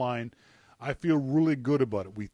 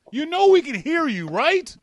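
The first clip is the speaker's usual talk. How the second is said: raised voice, high and loud; calling out loud; 13 semitones higher, 10 dB louder than usual. Real, not acted. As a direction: call out to get his attention. Calling out to distracted co-host.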